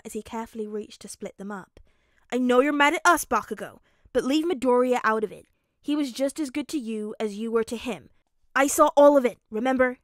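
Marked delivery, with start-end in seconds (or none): 2.3-10.0 loud, insistent telling-off; forceful intervention. Acted. Kirishima defending Midoriya to Bakugo, loud and firm. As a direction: raise your voice to break up the fight.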